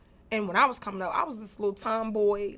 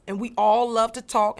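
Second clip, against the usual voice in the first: ordinary talk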